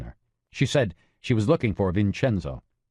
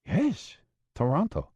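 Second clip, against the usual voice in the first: Maria's voice; 8 semitones higher than usual. Acted. Maria answers on phone.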